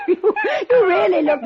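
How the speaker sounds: high burst of laughter on the word; laughing. Acted. Lady laughing at the man up on the chair.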